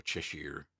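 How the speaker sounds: best go at an English accent on Cheshire. Shown as a demonstration. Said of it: "it's my best English accent".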